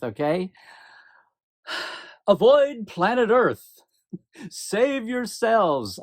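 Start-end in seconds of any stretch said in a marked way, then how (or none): 2.3-6.0 slow, higher, hammed-up warning with a laugh; hammed-up end-of-the-world plea; mock doom warning. To viewers, mock warning about the Earth finale, hammed-up and slow.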